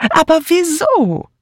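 very high, slow bewildered question; fussy friend, high-voiced and puzzled; bewildered Dora. (hohe, verständnislose Stimme der Freundin)

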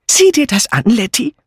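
high-pitched urgent alarm to her friend; aufgeregte alte Freundin. Acted. (aufgeregte, hohe Stimme der ängstlichen Freundin)

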